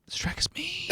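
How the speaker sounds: breathy dramatic whisper. Acted. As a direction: whisper it like a secret intro. Whispered start of session.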